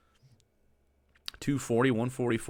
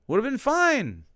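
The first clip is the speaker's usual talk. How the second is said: high, strained disbelief; exasperated complaint; 14 semitones higher, 4 dB louder than usual. Real, not acted. Griping at the video cook's choice, high exasperated wail. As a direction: wail it at the screen. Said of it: "I'm mad".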